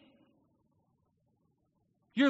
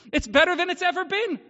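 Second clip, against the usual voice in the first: higher, louder ringing repeat; exultant again; 6 semitones higher, 6 dB louder than usual. Real, not acted. Pastor repeating to the congregation that life is better, exultant. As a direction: ring it out once more with joy.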